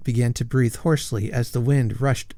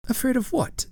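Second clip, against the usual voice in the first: surprised; 13 semitones higher than usual. Acted. Girl questions suitor sharply.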